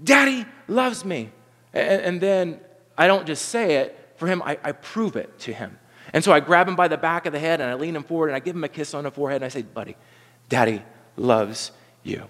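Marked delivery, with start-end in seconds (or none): 0.0-1.3 put-on kid voice. Boy repeating secret. 9.6-12.2 tender hush. Retold or imitated. Dad kisses son's forehead.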